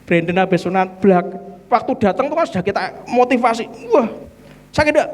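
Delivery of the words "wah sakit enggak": mock child asking, pitched well up; high child voice. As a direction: play the nervous kid asking if it hurts. Kids asking before circumcision.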